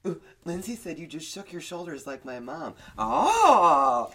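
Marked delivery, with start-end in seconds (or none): 3.0-4.1 loud high startled cry; surprised shout. Real, not acted. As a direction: yelp it out in shock.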